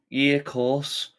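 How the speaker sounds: high, slow Scouse put-on; Scouse accent. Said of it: "I did a Scouse accent".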